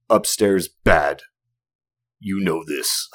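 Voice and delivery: loud, slow caveman grunt-talk; hammed-up fed-up caveman dad; caveman mode. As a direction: lay it down like an exasperated caveman. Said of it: "I'm in caveman mode".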